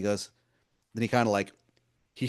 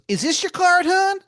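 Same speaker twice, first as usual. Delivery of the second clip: much higher and louder, a sweet-talking wizard calling you hun; Baltimore wizard voice; 10 dB louder than usual. Acted. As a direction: wheedle like a pushy old wizard begging a favor. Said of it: "And he goes".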